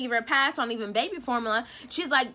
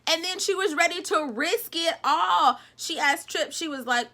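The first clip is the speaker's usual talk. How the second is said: ordinary delivery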